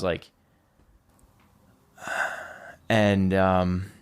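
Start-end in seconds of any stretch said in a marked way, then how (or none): 2.0-2.5 long unvoiced sigh; resigned sigh. Recalling having given up after paralysis, resigned sigh. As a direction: breathe out as if giving up.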